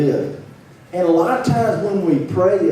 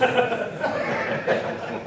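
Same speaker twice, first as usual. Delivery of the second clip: breathy laugh; 8 semitones higher, 6 dB quieter than usual. Real, not acted. Laughs at own simile.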